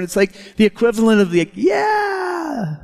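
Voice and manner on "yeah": high, long-held cheer without volume; mock cheer. The people responding to Ezra, high drawn-out mock cheer. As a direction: stretch it out way up high, like a crowd cheering. Acts out equivalent of crowd's amen.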